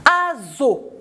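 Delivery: "Azo" as a-zo, voiced z. Shown as a demonstration. Teacher reads example to children, "Azo" with voiced z. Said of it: "J'entends ze, mais je vois S".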